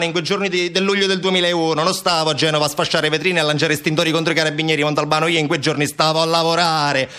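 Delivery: lightly acted self-justification, pitched above own voice; defensive colleague. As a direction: protest your innocence, building as you go. Colleague defends himself to Montalbano.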